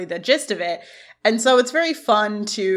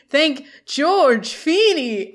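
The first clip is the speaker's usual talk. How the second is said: slow, higher, lightly theatrical delivery; grandly proclaimed credit; 6 semitones higher, 4 dB louder, much slower than usual. Retold or imitated. Homeless man crediting his benefactor for a mango, mildly grand. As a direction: draw it out with mock grandeur.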